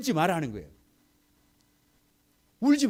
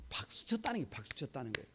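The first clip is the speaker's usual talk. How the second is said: hushed whisper; preacher whispering the shameful answer; 12 dB quieter, faster than usual. Real, not acted. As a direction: let it sink in, barely voiced.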